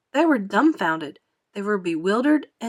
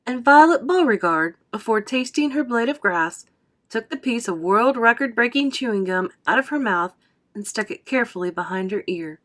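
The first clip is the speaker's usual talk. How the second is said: ordinary delivery, nothing marked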